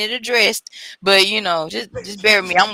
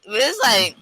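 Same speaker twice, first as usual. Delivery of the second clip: ordinary delivery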